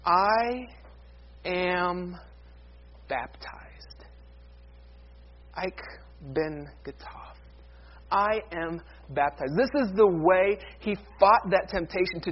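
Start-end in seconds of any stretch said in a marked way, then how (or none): 0.0-9.5 slow, soft, with long pauses; hushed solemn declaration. Acted. Preacher repeating Luther's words against temptation, hushed and solemn. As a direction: sink almost to a whisper, word by word.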